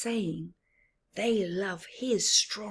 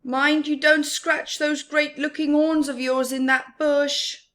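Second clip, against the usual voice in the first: loud, high teasing call; calling over to the bush; high, loud playful Nu; friendly Nu; 7 semitones higher, 6 dB louder than usual. Acted. Nu teases Rhino.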